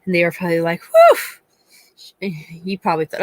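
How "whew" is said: very high, loud sigh of relief; loud relieved whew. Retold or imitated. Mom imagining her son's relief, high loud whew. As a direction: blow out relief like you got away with it.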